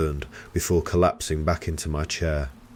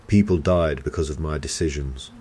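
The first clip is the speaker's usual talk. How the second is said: plain reading voice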